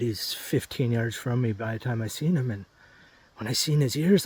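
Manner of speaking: usual speaking voice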